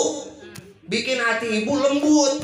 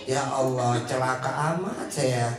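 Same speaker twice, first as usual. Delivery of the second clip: wry lament; 9 semitones lower, 3 dB quieter than usual. Retold or imitated. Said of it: "Langsung ngomong ibu".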